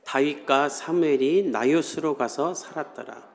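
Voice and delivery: scripture reading. Shown as a demonstration. Reads scripture aloud.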